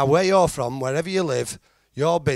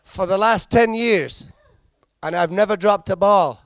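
proud, high-pitched boast; boastful goalkeeper; 6 semitones higher, 4 dB louder than usual. Acted. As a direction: announce it loud and high like a hero.

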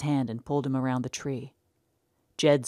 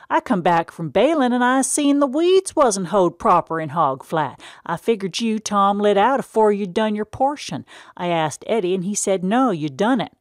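stern farmer father; 6 semitones higher, 8 dB louder than usual. Acted. Pa confronting Tom about unhoed weeds, loud stern country voice. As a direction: lay it on him like a stern old farmer. Pa scolding about unfinished chores.

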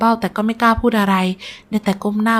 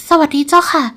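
higher, timid trembling greeting; 7 semitones higher than usual. A narrator's reading. Frightened young bride greeting the sick nobleman, trembling. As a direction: answer nervously, pitched up. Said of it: "เดือนฉายตอบกลับเสียงสั่น".

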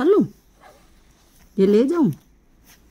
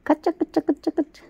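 high, quick, playful nonsense babble; 9 semitones higher, 4 dB quieter, faster than usual. Real, not acted. Owner fussing over her pet, high quick baby talk. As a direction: coo quick little syllables, high up.

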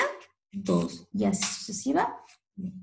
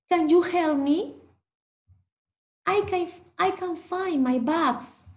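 pleading traveller; 5 semitones higher, 3 dB louder than usual. Acted. Anthony pleads for help.

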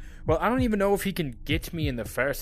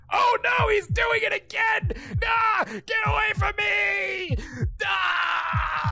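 shrill screaming, much higher; panicked high shrieks; slower than usual. Real, not acted. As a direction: shriek in panic to get away. Dad attacking again.

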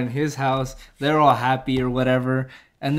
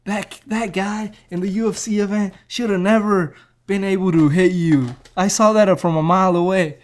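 put-on old trainer voice; 6 semitones higher than usual. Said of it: "He's like".

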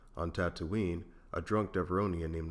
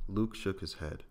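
ordinary delivery

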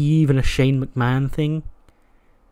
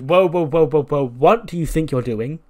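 calling out whoa; 2 semitones higher, 5 dB louder than usual. Retold or imitated. Alexa stops Breeze ripping pads.

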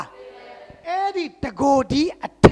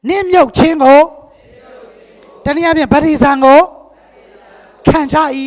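loud, ringing recitation; loud group recitation; 10 dB louder than usual. Real, not acted. Preacher leading the church in loud recitation. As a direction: proclaim it loudly together. Congregation repeats after preacher.

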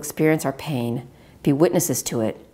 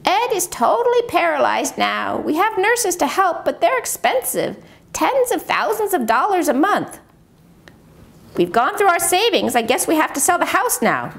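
caregiver in the video; 13 semitones higher than usual. Acted. Wife in the ventilator video tells her story, higher resigned voice.

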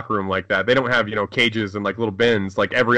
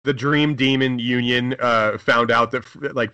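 ordinary talk, nothing marked

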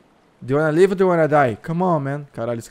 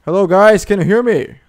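calling out; 5 semitones higher, 6 dB louder than usual. Real, not acted. Calling out to strangers in game.

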